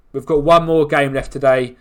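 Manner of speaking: ordinary talk, nothing marked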